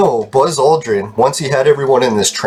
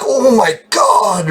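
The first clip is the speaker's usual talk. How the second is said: awestruck dread; 10 semitones higher, much slower than usual. Retold or imitated. Dread at seeing Buzz Aldrin.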